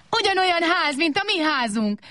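high, overjoyed cry; very high, happy truck voice; joyful. A narrator's reading. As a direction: jump for joy as you say it. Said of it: "ugrált örömében a teherautó".

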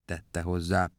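ordinary delivery, nothing marked

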